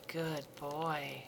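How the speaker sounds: normal talking voice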